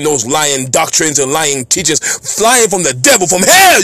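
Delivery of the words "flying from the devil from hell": fiery shout. Real, not acted. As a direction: voice leaps up on hell. Preacher climaxes denouncing false teaching.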